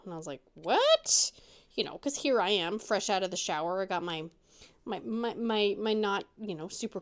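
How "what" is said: high, loud, incredulous "what"; shocked reaction. Retold or imitated. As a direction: burst out higher in retold disbelief. Retold shock at odd news.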